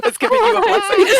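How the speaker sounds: high voice breaking into laughter; laughing. Real, not acted. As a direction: laugh through the words. Laughing through a joke.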